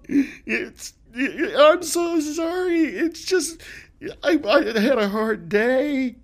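put-on high, slow weepy drunk; sad drunk. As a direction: blubber the apology slowly. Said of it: "Are you a sad drunk?".

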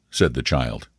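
usual speaking voice

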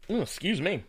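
burp into the mic; burping after fizzy drink. Real, not acted. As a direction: talk through a burp. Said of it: "I'm burping all up in the mic".

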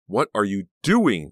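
loud, drawn-out indignant shout. A narrator's reading. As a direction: shout it at her, stretched out. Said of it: "Danielle shouted".

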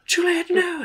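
high-pitched stage anguish; Romeo. Acted. Actor finding his beloved dead, high theatrical dismay. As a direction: cry it out high, like a melodramatic lover. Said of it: "he runs in there and he's like".

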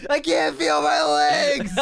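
high, loud, slow hammed-up wail; imitating an injured fighter on a stretcher crying; mock crying. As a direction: whine it like a hurt wrestler. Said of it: "he's, like, crying".

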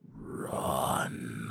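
very low, soft menacing growl; werewolf. Acted. Werewolf standing up to warn the gunmen, deep growl. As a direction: drop deep into a quiet, drawn-out rumble. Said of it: "the werewolf stands up and goes".

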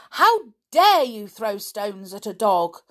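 loud, outraged yell; girl yelling at a bully in fury; furious yell. Acted. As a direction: let the fury burst out at him. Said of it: "yelled the little girl in a fury".

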